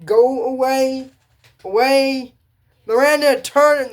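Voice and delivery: high, loud, slow pleading go away; urging warning. Acted. Viewer begging Miranda to turn away, high and loud. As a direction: wave her off the pirate ship.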